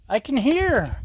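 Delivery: high, eager exclaim; thrilled to hear the sizzle; excited. Real, not acted. Hears the food sizzle.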